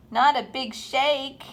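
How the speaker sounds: ordinary delivery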